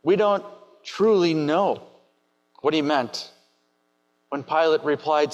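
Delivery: ordinary talk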